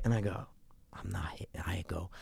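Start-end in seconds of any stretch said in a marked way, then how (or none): 0.9-1.5 very soft, low whisper; retold under-the-breath reluctance; hushed refusal. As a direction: whisper it as if to yourself. Hesitating to press the button.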